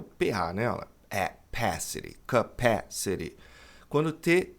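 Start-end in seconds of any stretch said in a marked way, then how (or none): 1.5-3.3 "capacity" as kuh-PASS-uh-ree, lower and slow; teacher's slow model of "capacity"; stressed PA syllable. Shown as a demonstration. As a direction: hit PASS in "capacity", tapping the T. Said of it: "A sílaba forte aqui é".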